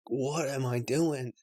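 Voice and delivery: soft, slow, hushed self-question. Retold or imitated. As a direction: ask it slowly and quietly, as if to yourself. Retold waking-up thought.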